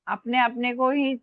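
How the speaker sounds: ordinary talk, nothing marked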